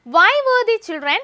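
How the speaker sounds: high, loud and very slow; teacher reading an exam question slowly. Shown as a demonstration. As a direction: dictate the question to the class. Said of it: "reading the exam question aloud".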